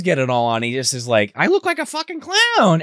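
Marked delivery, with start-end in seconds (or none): 1.3-2.8 high, whiny put-on voice; mock dismay. Candidate seeing himself in the clown suit, high and whiny. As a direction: whine it in disbelief.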